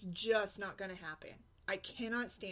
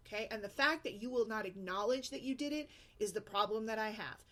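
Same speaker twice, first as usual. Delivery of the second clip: ordinary talk, nothing marked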